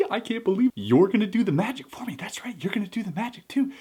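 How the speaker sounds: breathy, whispered astonished magician; whispered magician amazed at the viewer; magician impression. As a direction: hush it down to a whisper. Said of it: "Most impersonations of magicians".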